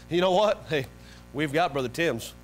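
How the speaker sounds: usual speaking voice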